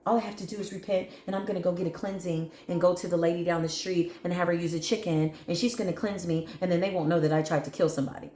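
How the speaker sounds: fast, dismissive rattling off; mocking imitation. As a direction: rattle off their lame excuse mockingly. Mimicking enemies' excuse.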